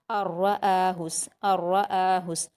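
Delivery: slow "अर्रआहुस" going straight into a doubled r, no nasal hum; merged without ghunna. Shown as a demonstration. Teacher demonstrating idgham, un-nasalised doubled r in "अर्रआहुस". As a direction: join the first vowel of "अर्रआहुस" directly to a firm r. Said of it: "बिना गुन्ना के पढ़ेंगे".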